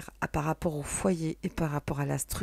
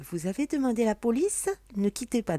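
higher, louder switchboard voice; mock phone recording; 6 semitones higher, 6 dB louder than usual. Joking to viewers with a mock police hold message.

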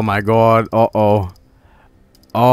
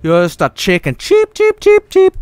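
very high chicken cheeps; squeaky mocking chicken taunt; Tommy Wiseau impression; 3 dB louder than usual. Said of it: "looks like Tommy Wiseau now".

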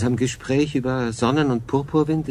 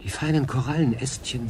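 ordinary delivery

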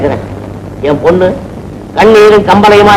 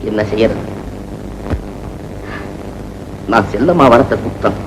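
mournful; 10 semitones lower, 10 dB quieter than usual. Acted. Father laments alone.

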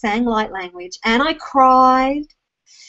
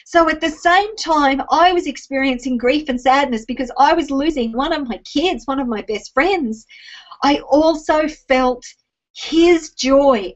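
ordinary delivery

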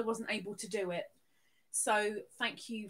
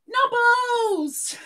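high, loud name called out; excited shout; 10 semitones higher, 11 dB louder than usual. Real, not acted. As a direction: shout a happy welcome. Greeting viewers in chat.

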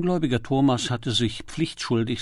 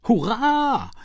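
high, loud cheer; jubilant cry; 11 semitones higher, 5 dB louder than usual. A narrator's reading. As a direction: cry out in sudden triumph. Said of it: "rief Lord Peter". (hoher Jubelruf)

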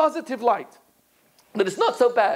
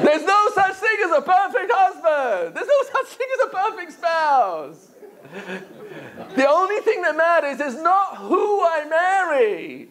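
laughing through words; 7 semitones higher than usual. Real, not acted.

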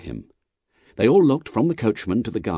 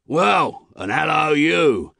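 high, loud hail thrown back; shouted reply; 8 semitones higher, 4 dB louder, slower than usual. A narrator's reading. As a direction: shout a greeting back in challenge. Jerry shouts back to guard.

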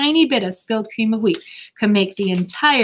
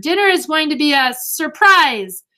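slow, raised, firm insistence; insistent; 7 semitones higher, 5 dB louder than usual. A narrator's reading. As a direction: hold the door and insist. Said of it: "she ordered".

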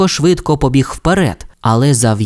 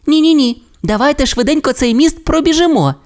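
high, fast little-dino urging; eager Timmi; faster than usual. A narrator's reading. Timmi refuses to go home, high and quick. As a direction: hurry everyone over the bridge. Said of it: "відповів Тімі".

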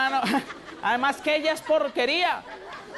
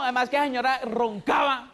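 ordinary delivery

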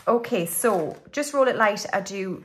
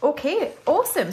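upbeat okay; 6 semitones higher, 5 dB louder than usual. Real, not acted. Cheerful restart of next section.

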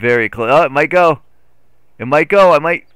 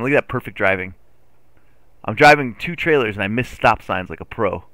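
usual speaking voice

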